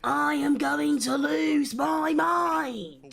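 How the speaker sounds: same words, slow and yelled; a slow, exasperated shout; mock frustrated yell. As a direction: drag it out and shout it. Repeats line, now yelled.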